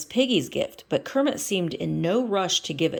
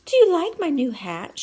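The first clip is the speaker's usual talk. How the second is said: Miss Piggy voice; 9 semitones higher, 5 dB louder than usual. Acted.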